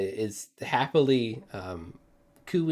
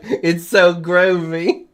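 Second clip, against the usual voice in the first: higher, loud, slow drawn-out groovy; groovy friar; 7 semitones higher, 11 dB louder, slower than usual. Acted. Friar strokes the boat's spikes, slow loud and groovy. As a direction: savour the word like a hippie. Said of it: "He's like".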